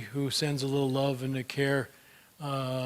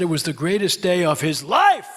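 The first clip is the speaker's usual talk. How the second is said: louder, higher, building to a big LIFE; triumphant; 4 semitones higher, 9 dB louder than usual. Retold or imitated. Boy boasts of beating his grandmother at Monopoly, triumphant. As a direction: crow it like the best victory ever.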